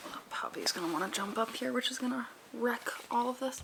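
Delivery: soft whispered aside; whispering. Real, not acted. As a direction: whisper so the dog stays calm. Whispering aside near sleeping dog.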